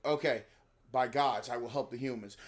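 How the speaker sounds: mock uninspired actor, low and subdued; reading off a script. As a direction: imitate a half-hearted line read. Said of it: "they gave him a script".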